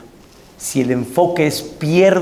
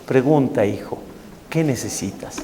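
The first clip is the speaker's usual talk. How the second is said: softer, calm and patient; calm, patient Hillel; 6 semitones lower, 7 dB quieter than usual. Retold or imitated. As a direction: gently invite the question, like a patient sage. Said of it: "así con calma se sentó y le dijo".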